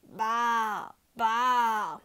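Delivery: bleating like a sheep. A narrator's reading. Pete the sheep speaks up, a bleating bah. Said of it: "said Pete".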